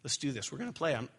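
soft, low, coaxing dad; a quiet loving father holding his son on his lap; loving firmness. Retold or imitated. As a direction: gently insist he stay and play. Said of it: "You can do that lovingly".

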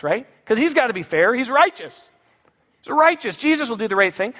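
ordinary talk, nothing marked

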